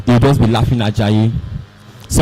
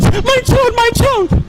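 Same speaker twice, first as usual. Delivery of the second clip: high panicked cry of a mother; frantic mother's cry; slower than usual. Acted. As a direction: shriek it as she runs to her fallen child. Mother runs to fainted son.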